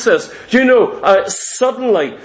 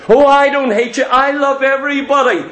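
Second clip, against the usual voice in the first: higher-pitched mock denial; higher, put-on protest of goodwill; protesting innocence; 4 semitones higher than usual. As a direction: lightly voice someone insisting on their innocence. Acts the hater's denial.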